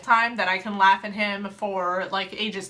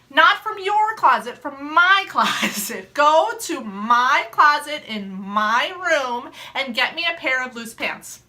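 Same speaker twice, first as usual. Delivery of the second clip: slow exasperated; 2 semitones higher, 4 dB louder, slower than usual. Retold or imitated. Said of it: "I'm like".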